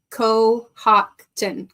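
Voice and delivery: slow, drawn-out Cohocton, each part stretched; sounding it out. Shown as a demonstration. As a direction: sound out Cohocton slowly, syllable by syllable. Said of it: "call the word to dinner".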